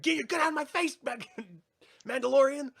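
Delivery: high, loud imitated yelling; mock scream. Co-host imitating Mayfield ribbing the Mandalorian, mock screaming. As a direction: yell it high, breaking into a laugh. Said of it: "screaming, like".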